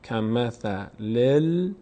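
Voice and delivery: کمثل ال- slowly as kamathalil, closing on l; slow teaching recitation of kamathalil; demonstrated joining. Shown as a demonstration. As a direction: draw out the syllables and stop on the doubled lam.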